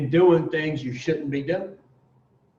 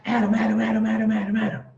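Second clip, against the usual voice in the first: excited calls, pitched higher; excited Eve; 6 semitones higher, much faster than usual. Acted. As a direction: call out to Adam, all worked up. Eve runs excitedly to Adam.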